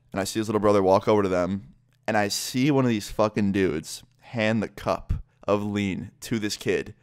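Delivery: usual speaking voice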